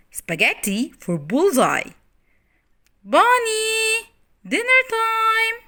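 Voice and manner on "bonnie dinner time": high loud call across the yard; high loud mother's call. Acted. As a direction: call the child in for dinner. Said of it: "a voice called out".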